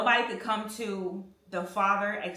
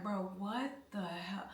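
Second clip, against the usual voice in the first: softly whispered, slower, in disbelief; 11 dB quieter, slower than usual. Retold or imitated. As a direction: breathe it out under your breath. Inner reaction while sitting there.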